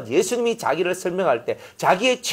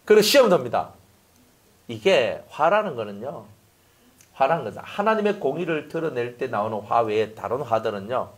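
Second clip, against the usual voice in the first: ordinary talk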